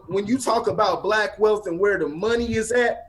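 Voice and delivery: ordinary delivery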